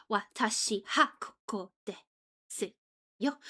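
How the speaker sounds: "Watashi ha" with the particle said "ha", syllables separated; halting misreading. Shown as a demonstration. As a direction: sound out "koko desu yo" haltingly, syllable by syllable. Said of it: "Or I could read this as".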